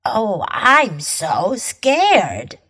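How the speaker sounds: slow mock fear, every word stretched; sarcastic, long-drawn pretend fright; drawn-out sarcasm. Shown as a demonstration. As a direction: drag out each word sarcastically. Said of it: "把这句话拉长每个字来说，表示讽刺".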